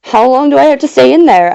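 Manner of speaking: lightly voiced nervous question; trembling voice. A narrator's reading. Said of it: "I asked in a trembling voice".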